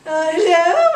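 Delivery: loud, high rich-lady greeting; hammed-up haughty hello; wealthy woman. Wealthy woman opening her door, haughty loud greeting. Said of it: "she's a wealthy woman".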